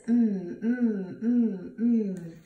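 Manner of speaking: "Mm" as a held hum; letter sound demo. Shown as a demonstration. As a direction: hum each "Mm" separately. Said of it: "Missy Mouse says".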